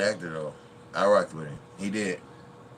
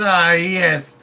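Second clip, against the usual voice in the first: movie line impression; 9 semitones higher, 11 dB louder, much slower than usual. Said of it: "He said".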